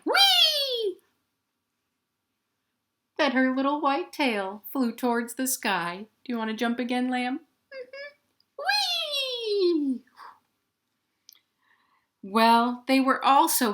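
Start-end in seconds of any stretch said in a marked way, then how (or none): 0.0-1.0 high squealing whee; gleeful jump cry; excited whee. Acted. Lamb jumps high, story. 7.7-10.0 high squeaky lamb voice, gleeful; squeaky lamb agreeing; lamb's squeaky yes. Acted. Lamb answers, jumps again.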